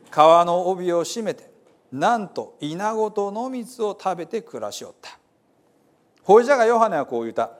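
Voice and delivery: earnest folksy Bible reading; dialect scripture reading. Acted. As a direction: proclaim the Gospel like a storyteller from Hiroshima. Reads Gospel in Hiroshima dialect.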